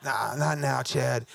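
low, worn-out refusal; broken, exhausted. Retold or imitated. Worn-out runner puts off his eager friend, low and broken. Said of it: "I was so broken".

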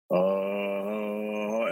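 long, level drone; imitated eerie noise. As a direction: imitate a distant noise with a drawn-out hum. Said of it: "I just heard this noise".

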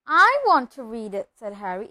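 loud demand, punched from the first word; indignant demand. Acted. Nephew claiming his own letter, indignant. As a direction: insist on your right to it. Boys quarrel over the letter.

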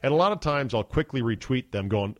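ordinary talk

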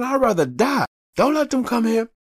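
high, loud put-on voice of a stern father; father forbidding his son to call the ambulance, stern and high; stern old father. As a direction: warn the boy off the phone like an angry dad.

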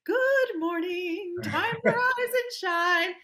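high, slow sing-song mom voice, imitating; mock sing a cheerful good morning; imitating mom's chirpy morning call; cheery wake-up call. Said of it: "My mother would say".

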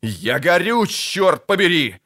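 drawn-out, strained curse; pained outburst. Acted. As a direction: cry out as if burning. Burning man cries out in pain.

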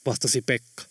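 usual speaking voice

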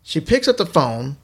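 usual speaking voice

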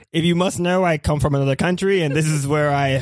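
usual speaking voice

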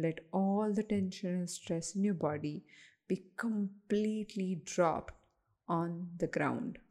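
unhurried, even-voiced relaxation coaching; guided meditation. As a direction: lead listeners gently through breathing, with pauses.

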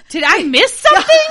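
high, loud, laughing bewilderment. Retold or imitated. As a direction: squeal it out in disbelief. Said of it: "I was like".

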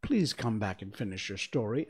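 usual speaking voice

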